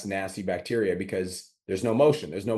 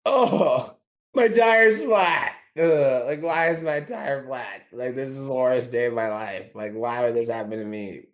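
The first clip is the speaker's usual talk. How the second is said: whiny complainer; 3 semitones higher, 3 dB louder, slower than usual. Retold or imitated. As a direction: whine like life is over. Whiny driver with flat tire.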